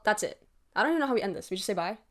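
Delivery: ordinary delivery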